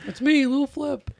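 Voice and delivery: high, slow put-on rapper voice; [name] impression. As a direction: announce yourself high and slow. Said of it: "a great [name] impression".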